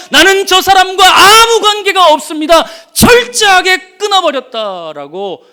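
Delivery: high, loud emphatic cry of denial; forceful denial. Acted. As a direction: preach the denial at full volume. Peter's denial, preaching at full force. (높고 큰 목소리로 강하게 부인)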